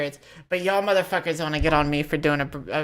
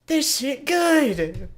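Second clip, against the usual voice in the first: very high, loud, stretched-out rave; hammed-up foodie outburst; delighted diner's exclamation; 10 semitones higher, 5 dB louder, much slower than usual. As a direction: draw it out in over-the-top approval. Repeats the imagined diner's rave.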